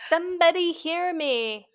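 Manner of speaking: mock an old-fashioned cry for help; mock damsel plea. Locked-up woman from the sixteen hundreds, hammed-up plea. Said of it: "Are you in the sixteen hundreds?".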